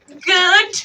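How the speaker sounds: high, loud indignant question; small creature taking offence; affronted mouse. Acted. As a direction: throw it back in disbelief.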